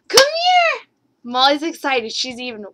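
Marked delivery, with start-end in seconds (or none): 0.1-0.9 high, loud call to the dog. Real, not acted. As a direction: sing out for the puppy to come. Calling the dog over.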